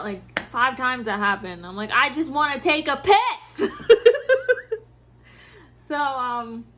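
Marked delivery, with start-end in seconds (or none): 1.9-3.7 raised voice tipping into laughter; half-laughing, climbing wail of frustration; exasperated cry. Retold or imitated. Worker who can't get to the bathroom, comic exasperation. Acting out her desperation, amused. 3.8-4.8 loud high-pitched laugh; burst of laughter. Real, not acted. Worker laughing at her bathroom woes, loud cackle.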